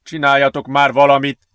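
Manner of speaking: loud, desperate urging; desperate plea. A narrator's reading. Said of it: "kétségbe esetten hadonászott feléjük".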